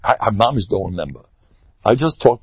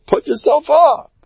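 emphatic urging; 3 dB louder than usual. Real, not acted. Urging the listeners to self-confidence.

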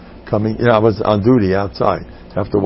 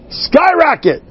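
high soaring "skyrocket"; excited; 3 dB louder, slower than usual. Real, not acted. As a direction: launch the word upward. Enthusiastic climax of wish.

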